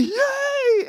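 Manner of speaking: very high gleeful yelp; mock cheer. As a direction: squeal for joy as you burst up. Someone leaping out of water.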